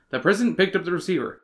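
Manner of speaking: ordinary talk, nothing marked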